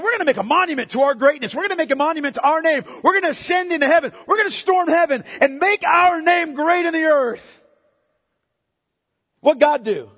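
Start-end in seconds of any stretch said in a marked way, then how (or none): 0.0-7.6 higher, fast, building proclamation; grand boast. Acted. Preacher voicing Babel's builders, boastful. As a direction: rattle off the boast with rising force.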